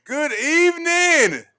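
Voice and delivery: very loud, high, drawn-out holler; carried-away, booming hello; real yell. Real, not acted. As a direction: belt the greeting out slowly at full volume. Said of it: "yell so loud?".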